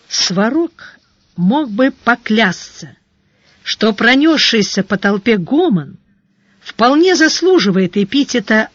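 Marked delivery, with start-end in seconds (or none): none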